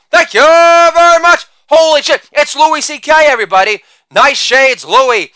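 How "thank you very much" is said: hammed-up stage thank-you; showman sign-off. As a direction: stretch out the thanks like a showman taking a bow.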